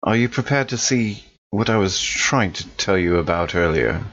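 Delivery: ordinary delivery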